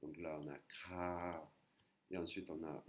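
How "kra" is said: "Kra" with the A held out slowly; long A. Shown as a demonstration. Teacher demonstrating the long Thai vowel, lengthened A. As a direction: stretch the vowel at the end of "Kra". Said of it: "C'est le A long".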